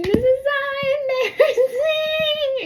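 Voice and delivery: very high, loud, drawn-out wail; mock laughing-crying wail. Stuck girl embarrassed before rescuers, high mock wail. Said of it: "I'm, like, laughing and saying".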